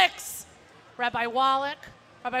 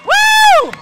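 cheering; 19 dB louder than usual. Real, not acted. Cheering at a parade.

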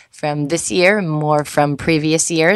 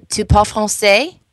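French accent; 4 semitones higher than usual. Shown as a demonstration. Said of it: "That was a very good accent".

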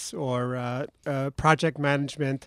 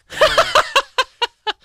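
breathless laugh; 7 dB louder than usual. Real, not acted. Guest arrives breathless.

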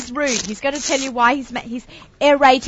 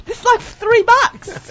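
laughing; 10 semitones higher, much slower than usual. Real, not acted. Laughing at rinsing with wine.

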